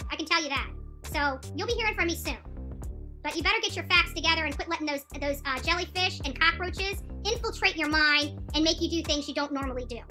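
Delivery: ordinary talk, nothing marked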